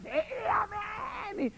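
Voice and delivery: high tearful crowd shout; hammed-up weepy amen; mock tearful amen. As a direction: imitate an overcharged congregation. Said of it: "Y todos".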